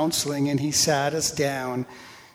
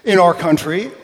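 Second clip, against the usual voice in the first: loud, indignant mock scolding; over-the-top retold telling-off; getting really stupid; 4 semitones higher, 10 dB louder than usual. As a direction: raise your voice in silly outrage. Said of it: "Now I'm getting really stupid".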